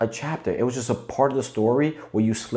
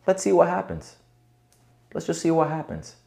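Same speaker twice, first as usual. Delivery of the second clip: curious shrug; 8 semitones higher than usual. Shown as a demonstration. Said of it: "I want you to develop the attitude of".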